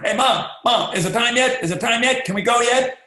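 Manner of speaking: high, loud, eager pestering; a child who can't wait for the trip; impatient excited kid. Retold or imitated. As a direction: kid begging mom at three in the morning, eager. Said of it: "same kid to go to Disney World".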